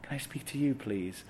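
imitating a very soft-spoken man; hushed, meek request; Paul. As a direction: plead softly, frail and scared. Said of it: "a really soft voice".

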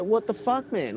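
mock shocked exclamation; baffled outrage. Retold shock about the tent.

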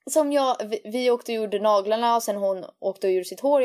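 ordinary talk, nothing marked

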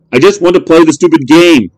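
high, loud pleading outburst; frustrated kid. Acted. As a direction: beg the machine angrily. Boy raging at machine.

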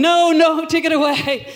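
mock panicked refusal. Acts out refusing a choice.

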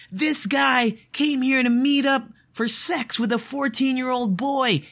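high-pitched public call-out, no real volume; mock-yell an accusation to make heads turn; imitated yelling. Imitating a predator catcher yelling to humiliate someone in public, mock yelling. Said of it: "He starts yelling".

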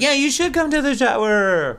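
high, overly sweet invitation; sugary, hammed-up politeness; mock nice invite. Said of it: "Marissa tries to be nice".

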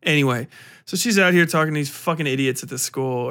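ordinary talk, nothing marked

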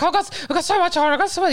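overwhelmed whine, sped up; mock frantic. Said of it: "I was like".

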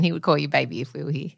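usual speaking voice

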